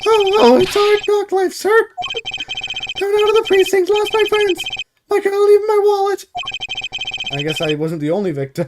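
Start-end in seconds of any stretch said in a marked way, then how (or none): 0.0-6.2 high meek bailiff voice. Acted. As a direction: squeak it out like a timid bailiff.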